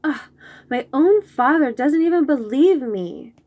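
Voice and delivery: loud, hurt protest; offended complaint. Acted. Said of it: "I say".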